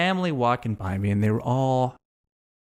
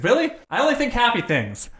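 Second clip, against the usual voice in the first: Miriam's chirpy reply; 8 semitones higher than usual. Retold or imitated. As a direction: play Miriam as a sunny optimist who can't relate. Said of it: "She's like".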